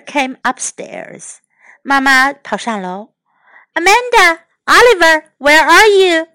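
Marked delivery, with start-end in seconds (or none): 3.7-6.3 raised, louder searching call. A narrator's reading. Mother looks for her children, calling out. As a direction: call the children by name, voice lifted.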